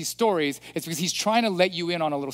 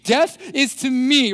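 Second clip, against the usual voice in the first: higher, louder and very slow, Isaiah in dread; preacher voicing a frightened Isaiah in a drawn-out cry; 7 semitones higher, 6 dB louder, slower than usual. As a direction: wail it out as a terrified prophet. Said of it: "He gets frightened and really scared".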